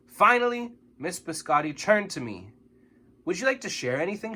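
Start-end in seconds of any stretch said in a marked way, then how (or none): 3.3-4.4 teacher asking. A narrator's reading. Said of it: "she asked".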